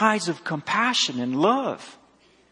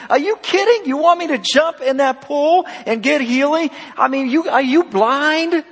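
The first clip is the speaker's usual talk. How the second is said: exasperated, mocking sarcasm pitched much higher; sarcastic rant; 8 semitones higher than usual. Preacher voicing the sick man's retort to God, high exasperated sarcasm. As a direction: throw it back at God like an incredulous complaint. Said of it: "I'm gonna translate what this guy's saying".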